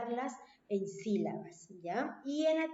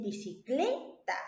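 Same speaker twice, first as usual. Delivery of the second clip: "bicicleta" with CLE drawn out, then TA set apart; stress on CLE; slower than usual. Shown as a demonstration. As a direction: lean on the penultimate CLE in "bicicleta". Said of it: "Lleva la mayor fuerza de voz".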